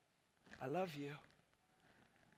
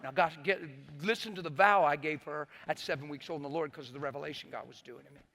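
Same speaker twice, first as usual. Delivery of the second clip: ordinary talk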